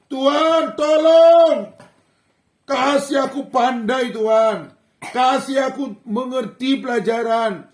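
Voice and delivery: loud, high crying out to God; a frightened student calling out in prayer; desperate plea. Retold or imitated. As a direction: beg for help with all your voice. Child pleading to God before exam.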